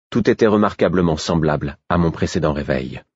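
usual speaking voice

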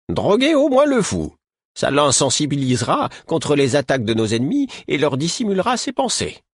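put-on a high old healer lecturing two youths; old woman Caudron scolding. A narrator's reading. Caudron proposes plan.

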